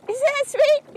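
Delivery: high, loud squealed gush over her craft; squealing gush. Acted. Crafty TV host gushing to viewers, high squeal. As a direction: squeal it with delight.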